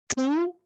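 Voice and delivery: "Tu" said high like the pronoun tu; pronounced as tu; sounded like "tu". Shown as a demonstration. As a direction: say "Tu" like the Portuguese pronoun. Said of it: "Como fosse o pronome tu".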